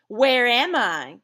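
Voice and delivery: loud, slow, drawn-out question; hammed-up alarm. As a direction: act like a bewildered captive. Example question of abducted person.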